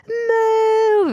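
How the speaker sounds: high, loud panicked yell of "Move"; screamed warning. Retold or imitated. Warning the pedestrian as luggage crashes down, a high scream. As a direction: shriek it at the woman below. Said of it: "I start screaming".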